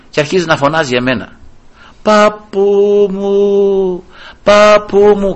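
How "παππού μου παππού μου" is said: lightly voiced weak child calling grandpa; drawn out, slow feeble call; a hint of a fading child. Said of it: "ξεψυχισμένα". (αδύναμο παιδί που φωνάζει τον παππού, ελαφρά)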